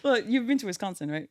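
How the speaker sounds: normal talking voice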